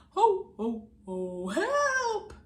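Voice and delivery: loud high ho ho help; Santa voice. Acted. Santa in trouble.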